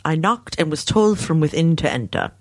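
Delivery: a hint of British English, read like a novel; British accent. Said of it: "I'll even do it in a British accent".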